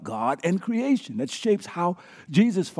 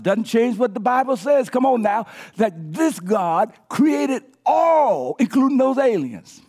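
rising, louder sermon climax; booming preacher voice; preaching at full force; 6 semitones higher, 4 dB louder than usual. Acted. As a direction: build the praise higher and higher.

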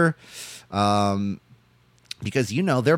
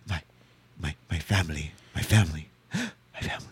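low, halting mock sob, ending in a gasp; hammed-up tearful stammer with pauses; mock crying; 7 semitones lower, 6 dB quieter than usual. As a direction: imitate a man choking up. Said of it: "he's, like, crying backstage".

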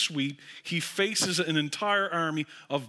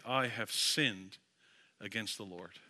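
repentant confession; 9 semitones lower, 8 dB quieter than usual. Acted. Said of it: "this is what you say".

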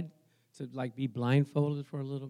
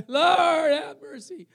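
desperate cry out; 12 semitones higher, 6 dB louder, much slower than usual. Acted. Preacher acts out being trapped at the Red Sea, desperate high cry. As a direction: cry up to heaven for rescue.

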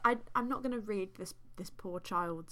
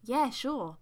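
ordinary delivery

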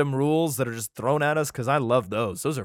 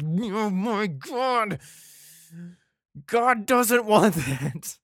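higher, slow and drawn out, breaking into a laugh; a hammed-up scandalised husband; mock pious shock; 7 semitones higher, much slower than usual. Husband sees his wife wearing his tunic, scandalised and laughing.

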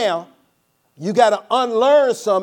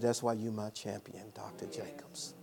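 much softer, lower praise for Doctor Jacobs; fond praise; 7 semitones lower, 17 dB quieter than usual. Retold or imitated. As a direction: murmur it with admiration.

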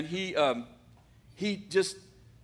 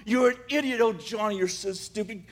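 scolding himself; 5 semitones higher than usual. Retold or imitated. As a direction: berate yourself all the way home. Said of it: "he says to himself".

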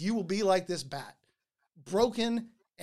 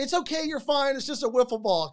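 high-pitched, anxious placating; flustered "you're fine" to a crowd; hurried reassurance; 6 semitones higher, 5 dB louder than usual. Retold or imitated. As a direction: play down the panic, pitch jumping up. Calming a panicked crowd in story.

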